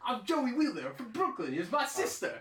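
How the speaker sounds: high cartoon Brooklyn accent. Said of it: "Brooklyn style accent".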